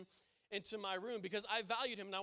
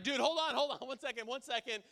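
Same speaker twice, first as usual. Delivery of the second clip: loud, higher call to be heard through a door; 5 semitones higher, 7 dB louder than usual. Retold or imitated. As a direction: shout over the TV for him to wait. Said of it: "and I'm like".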